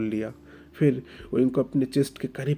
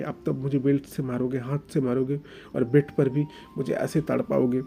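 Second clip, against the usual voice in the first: put-on higher, louder hurt young man, stammering; anguished outburst; 4 semitones higher, 5 dB louder than usual. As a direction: pour out the pain in a rising outburst. Oing's outburst about pain. (दर्द भरा फूटता हुआ ग़ुस्सा)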